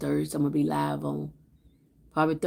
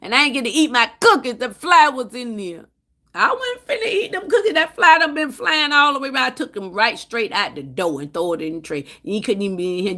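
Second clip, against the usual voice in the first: normal talking voice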